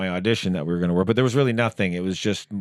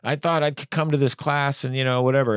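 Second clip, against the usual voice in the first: imitating Tom; 6 semitones higher than usual. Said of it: "He goes".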